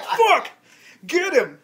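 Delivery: sudden mock shout at a fleeing suspect; retold cop yell.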